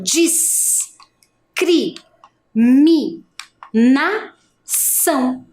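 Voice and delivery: "discriminação" stretched very slowly, syllable by syllable. Shown as a demonstration. Teacher modelling syllables for the class, slow. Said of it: "Então eu já falo".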